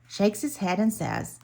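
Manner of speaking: ordinary delivery, nothing marked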